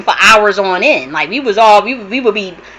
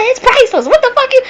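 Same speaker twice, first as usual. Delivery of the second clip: much higher, incredulous retort; indignant disbelief. Retold or imitated. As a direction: fire it back at the caller, baffled. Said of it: "I said".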